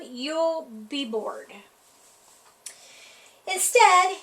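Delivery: usual speaking voice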